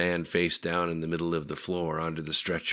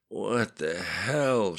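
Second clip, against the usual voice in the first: slow, dry rasping question; slow dazed words from a dry throat; dry-throated croak; 4 semitones higher, much slower than usual. A narrator's reading. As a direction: push it through a parched throat. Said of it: "through the dryness of her throat".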